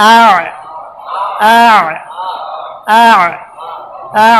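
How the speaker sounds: "আ" closing into a throaty ayn; hamza then ayn. Shown as a demonstration. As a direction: start "আ" on a hamza and squeeze it into mid-throat. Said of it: "হামজা আইন জবর". ("আ"-এর শেষে গলার আইন)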